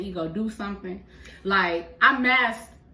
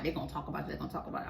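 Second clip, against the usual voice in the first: mock hushed warning; 5 semitones lower, 11 dB quieter, much faster than usual. Imitating gossip warning, hushed.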